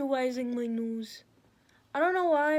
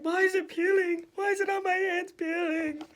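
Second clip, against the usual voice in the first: high-pitched fretful whine, played up; comic panicked squeal; mock panic; 6 semitones higher than usual. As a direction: imitate yourself freaking out, pitched high. Acts out own panic about peeling.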